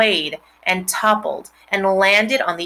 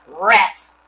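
loud muttered oath; disgusted curse; 5 dB louder than usual. A narrator's reading. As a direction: spit it out in disgust. Lurvy finding rat nest.

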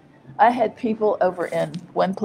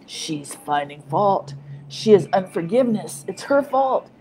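mocking accusers; 4 dB louder than usual. Preacher mimicking critics who blame her. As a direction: parody the critics' self-righteous blame. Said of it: "they counteract with".

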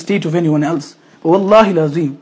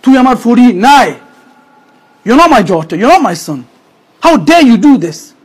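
angry parent; 3 semitones higher, 5 dB louder than usual. Retold or imitated. Parent disowning a child who did wrong, angry shout. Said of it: "when the crunch comes".

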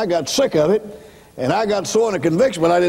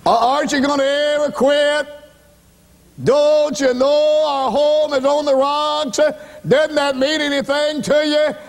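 pleading wife; 10 semitones higher than usual. Retold or imitated. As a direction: beg him to stop like a desperate wife. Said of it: "my wife came in and squatted down in front of me and said".

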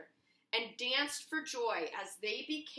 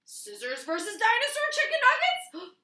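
high, loud match call; announcer excitement; 11 semitones higher, 9 dB louder than usual. Acted. Storyteller announces Scissors against the nuggets, big excited call. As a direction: call the bout like a ring announcer.

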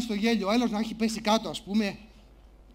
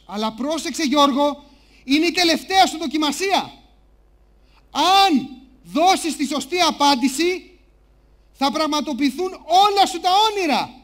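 loud, raised host voice, slowly stretched; game show host; 2 semitones higher, 7 dB louder, slower than usual. Acted. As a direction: announce the final challenge to the contestant. Game show host warns George.

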